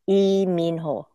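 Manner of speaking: Lee Min-ho, Lee and Min on one vowel, run together; Korean pronunciation. Shown as a demonstration. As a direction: Lee Min-ho said low, i mĩnho, syllables joined. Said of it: "there is no short I sound in Korean".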